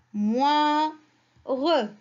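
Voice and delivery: "moi, re" as separate held syllables, pause between; syllable-by-syllable reading. Shown as a demonstration. Teacher gives children the remaining syllables, "moi, re" spaced apart. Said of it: "On a la première syllabe".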